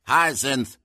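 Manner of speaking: loud, high, breathless call; out of breath. A narrator's reading. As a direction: call her name, winded from the stairs. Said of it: "out of breath from taking four flights".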